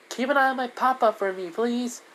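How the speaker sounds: put-on high-pitched kid making a request; little girl asking. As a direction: ask sweetly as a small child. Child asks favour before leaving.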